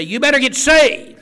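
shouted, high and loud; mock harsh demand. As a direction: bark it like a pushy street preacher. Mock heavy-handed witnessing.

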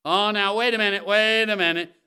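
loud, high mock protest; hammed-up shouted interruption; objector cutting in. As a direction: break in loudly like a doubter objecting. Voicing an objector interrupting him.

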